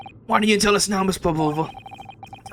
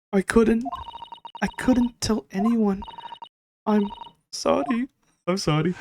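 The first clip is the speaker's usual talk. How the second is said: higher, slow, hushed apology; tearful confession; 5 semitones higher, 4 dB quieter, slower than usual. Acted. The lady confesses to the detective, hushed and slow. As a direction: plead sorry, barely holding together.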